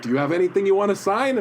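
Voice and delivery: high, quick quoted question; imitated friendly offer. Voices Stan offering to sign.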